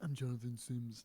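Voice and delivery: lightly voiced, a hint of Jonathan Sims. Shown as a demonstration. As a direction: try a quick Jonathan Sims voice, barely changed. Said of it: "Can you do the Johnny Sims voice?".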